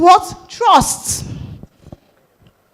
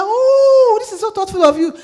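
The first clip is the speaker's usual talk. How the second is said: high, crying-edged gasp of delight; a choked, teary thank-you; touched surprise; 11 semitones higher, 5 dB louder than usual. Retold or imitated. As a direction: act overwhelmed and moved by the gift. Client moved by surprise cake.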